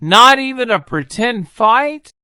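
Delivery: put-on high, hopeful boy's question; a boy pleading with the dragon. A narrator's reading. Boy asks the dragon for a pretend fight, hopeful and high. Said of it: "asked the boy".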